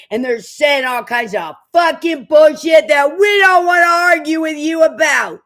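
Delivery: shouting down the nonprofits; furious shout. Real, not acted. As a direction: blast them with rage. Ranting against nonprofits.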